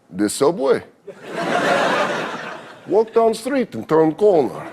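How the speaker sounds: put-on slow, thick Russian accent with a laugh; Russian giver of directions. Comic imitating a Russian giving directions. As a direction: point the way like a gruff Russian.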